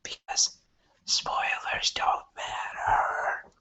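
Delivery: hushed whisper, slow. Pretend. Whispering before dropping a spoiler. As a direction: breathe it out like a secret.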